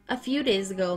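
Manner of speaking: ordinary delivery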